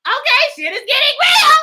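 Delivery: high, loud, frantic warning yell; mock panicked shout. Lady on the news yelling at the town to shut down, high frantic shout. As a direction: shout it like the sky is falling.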